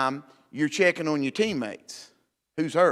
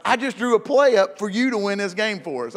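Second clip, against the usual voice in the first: exasperated coach; 6 semitones higher, 4 dB louder than usual. Retold or imitated.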